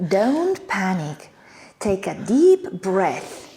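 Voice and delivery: very slow, acted reassurance; calming. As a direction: slowly talk someone down from panic. Calms someone in a sketch.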